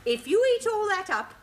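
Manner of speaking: normal talking voice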